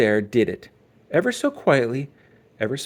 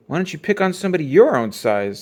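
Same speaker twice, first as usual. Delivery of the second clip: mocking; 5 semitones higher, 6 dB louder than usual. A narrator's reading. Too Tall mocking Brother back.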